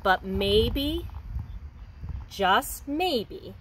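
gentle storybook reading for a class; reading to children. Acted. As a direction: read it like a picture book to young kids. Teacher reads picture book aloud.

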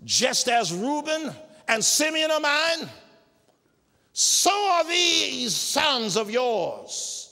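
high, loud, slow declaration; Jacob declaring. Acted. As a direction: proclaim it slowly as the old patriarch. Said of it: "Jacob says".

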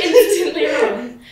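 slow, laughing through the words. Real, not acted. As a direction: giggle out the verdict. Laughing at a debunked myth.

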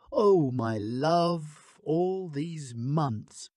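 very slow, aching tenderness; a husband mourning his dead wife, slow and grieving. A narrator's reading. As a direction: let each word break with grief.

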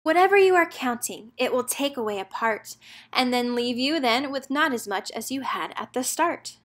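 usual speaking voice

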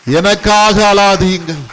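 loud, slow, high proclamation; proclaimed quote. Shown as a demonstration. Preacher to congregation, repeating Jesus's words loudly and slowly. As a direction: ring it out to the whole church. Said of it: "ஒரு கரங்களை தட்டுவோமா?".

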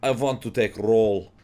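imitated Russian-accented teacher. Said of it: "she did it in a Russian accent".

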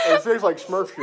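laughing hard while talking; cracking up. Real, not acted. Laughing at the blue candy.

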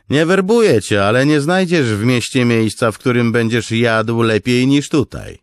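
loud, high friendly pitch; coaxing salesman. Acted. Noro tempting Kaladin with food, loud friendly sales pitch. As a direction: sell him the good life.

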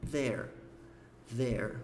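"There" with tongue out at the start and rounded lips at the end; tongue tip out for th, pucker on the r of "There". Shown as a demonstration. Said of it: "pontinha da língua ... R com biquinho". ("there" com a língua e com biquinho)

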